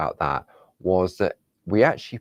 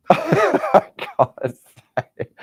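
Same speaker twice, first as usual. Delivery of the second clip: laughing through the words, slow and higher; 13 semitones higher, 7 dB louder, slower than usual. Real, not acted. Presenter stumbling over a flower name, laughing. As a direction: giggle helplessly as you give up.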